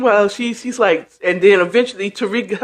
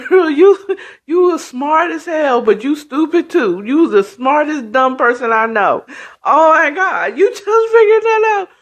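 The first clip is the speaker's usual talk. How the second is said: high, laughing voice, acted out; laughing mockery; 7 semitones higher than usual. Mother-in-law laughing at the smartest dumb person, mocking. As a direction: tease through giggles, pitch jumping up. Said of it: "she started laughing at her".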